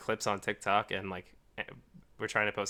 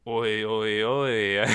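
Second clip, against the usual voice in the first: mock British oi; 7 dB louder, much slower than usual. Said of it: "they were like".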